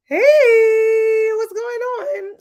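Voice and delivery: high, loud, slow stretched hello; loud sing-song greeting. Real, not acted. Host calling out hello to a newcomer, high and loud. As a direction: call out a big welcome. Greeting viewer joining stream.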